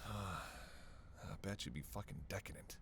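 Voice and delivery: very soft, slow, low murmur; hushed flirting. Acted. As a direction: say it slowly, almost to yourself, savouring the thought. Flirting about how listener would taste.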